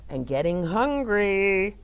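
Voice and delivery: sighing bored read; weary waiting. Acted. Teacher reads to the children, acting bored and sighing. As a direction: sigh through the waiting.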